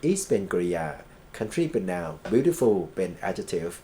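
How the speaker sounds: usual speaking voice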